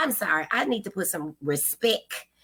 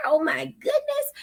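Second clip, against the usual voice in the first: high, gasping dismay; shocked oh my; 12 semitones higher than usual. Real, not acted. Host hears a viewer fell off the porch, shocked. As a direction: squeal it out in shocked sympathy.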